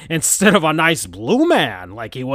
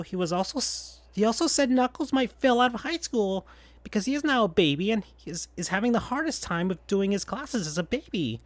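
higher, much softer caring friend; Tails' voice; 7 semitones higher, 7 dB quieter than usual. Acted. Tails telling Sonic the news, high and soft. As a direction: gently reassure him.

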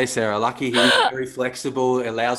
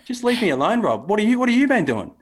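high, whiny plea with a laugh; mock whiny boss; 11 semitones higher, 3 dB louder than usual. Imitating the boss in the bath pleading to be left in peace. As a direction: play him begging to be left alone. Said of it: "imitating the boss being left alone".

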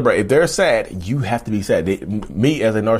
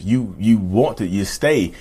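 ordinary talk

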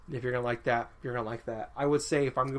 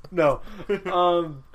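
laughing; 5 semitones higher, 8 dB louder, much slower than usual. Real, not acted. Laughs at co-host's score.

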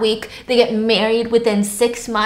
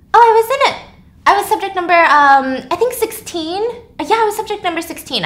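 bubbly Amy; 7 semitones higher than usual. Acted. Amy on her way to the airport, high bubbly chatter. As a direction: bounce through it cheerfully.

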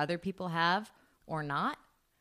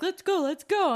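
put-on eager young cat; 8 semitones higher, 4 dB louder than usual. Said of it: "Charles will be like".

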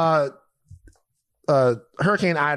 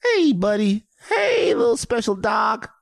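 higher, sweet pet talk; dog voice; 9 semitones higher than usual. Shown as a demonstration. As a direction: coo to your dog. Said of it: "What's yours?".

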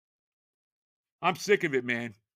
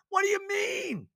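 outraged question; 12 semitones higher than usual. Real, not acted. Fan mocking his coach's excuse, outraged shout. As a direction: throw it back in disbelief.

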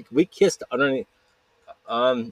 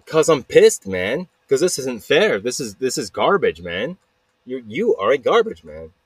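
loud, indignant rant with punched words; pissed off; 3 semitones higher, 4 dB louder than usual. Acted. Blaine answering why he gets mad, pissed off. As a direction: blurt it out, annoyed and loud.

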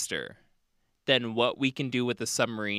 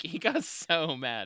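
usual speaking voice

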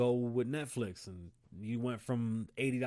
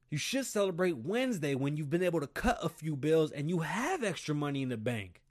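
ordinary talk, nothing marked

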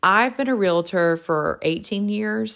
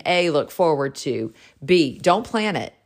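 usual speaking voice

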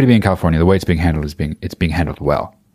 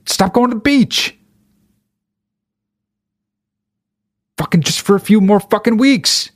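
much higher, strained exasperated yelling; exasperated rant; 12 semitones higher than usual. Real, not acted. Ranting at beachgoers who ignore the lockdown, exasperated high yell. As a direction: plead with them at the top of your voice.

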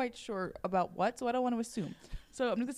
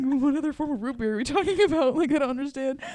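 exasperated, louder and higher; loud mock bewilderment at a silly question; retold incredulous outburst; baffled; 3 semitones higher, 7 dB louder than usual. Acts out baffled reply to man.

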